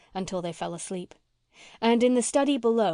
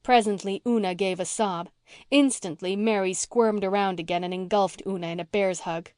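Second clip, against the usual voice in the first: ordinary delivery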